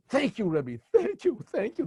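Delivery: high, tearful thank yous. Acted. Couple thanking the Rebbe, crying. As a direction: thank the Rebbe through tears. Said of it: "They're crying".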